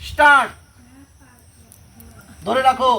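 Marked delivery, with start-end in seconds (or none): 0.1-0.5 loud start call. Real, not acted. Yoga teacher calls start to class.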